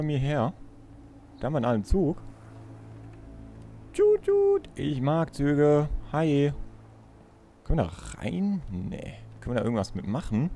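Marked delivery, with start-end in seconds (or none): none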